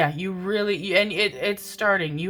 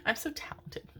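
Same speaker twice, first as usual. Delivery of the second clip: higher, whispered self-mockery; sarcastic whisper; 11 semitones higher, 9 dB quieter than usual. Real, not acted. Reader mocking her own miscount to viewers, sarcastic whisper. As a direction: whisper it, teasing yourself.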